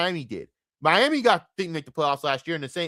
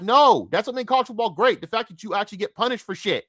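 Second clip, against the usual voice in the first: raised pitch rant; 8 semitones higher, 4 dB louder than usual. Retold or imitated. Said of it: "I'm like".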